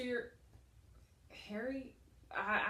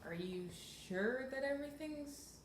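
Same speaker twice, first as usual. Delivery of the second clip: ordinary talk, nothing marked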